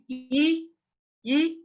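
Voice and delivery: E held long and high; E as a sustained teaching vowel; long E sound. Shown as a demonstration. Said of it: "and I E sound".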